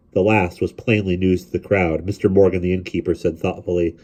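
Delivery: usual speaking voice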